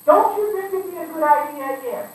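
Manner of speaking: high, loud, leading suggestion; coaxing fake question. Preacher acting someone steering a friend with a question. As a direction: dress an order as a question.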